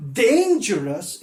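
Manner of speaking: DAN-gerous, slow, with DAN punched; stress on first syllable. Shown as a demonstration. Teacher showing the correct accent, first syllable stressed. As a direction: hit DAN in "dangerous", let the rest fall away. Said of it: "with more pressure".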